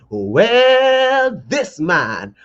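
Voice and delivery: slow, drawn-out mock preacher call; hammed-up preacher; little preacher voice. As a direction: preach it from the pulpit, slowly. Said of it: "let me put on my little preacher voice".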